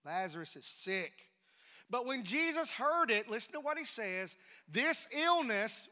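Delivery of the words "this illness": slow reading. Shown as a demonstration. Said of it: "what did he say?".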